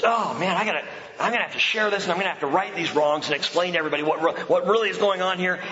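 fast, hurried mock outrage; light self-mocking hurry; retold rush of indignation. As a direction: rattle it off like you must fix everything now.

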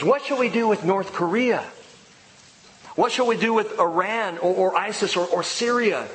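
high, insistent rhetorical questions from the pulpit; fiery preacher's list; preaching rhetorical list. Real, not acted. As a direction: rattle off the world's worries. Mock list of worldly questions.

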